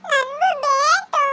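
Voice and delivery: put-on high, slow tearful child's demand, held on ಬೇಕು; crying demand. A child insists to her mother, wailing. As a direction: wail it again like a child.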